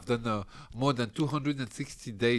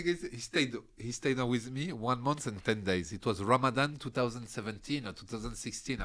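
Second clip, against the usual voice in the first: ordinary talk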